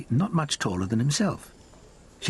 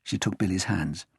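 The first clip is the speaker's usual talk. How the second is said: ordinary delivery, nothing marked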